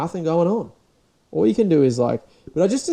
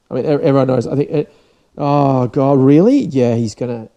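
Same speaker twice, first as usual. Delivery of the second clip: ordinary delivery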